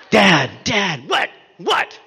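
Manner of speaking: mild mock yell; calling Dad. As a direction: shout for Dad like a pestering kid. Kid calling, dad answering.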